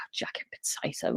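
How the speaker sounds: whispered with a sigh. Real, not acted. Whispered sigh at the nurses' jacket potato. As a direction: breathe it out in disbelief. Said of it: "sighs the words".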